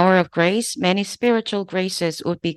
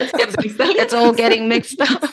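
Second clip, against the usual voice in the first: laughing; 4 semitones higher, 3 dB louder, slower than usual. Real, not acted. Laughing at her mixed accent.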